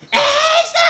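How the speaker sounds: high shrieking cry of a name; anguished scream. Pretend. Luffy screaming Ace's name.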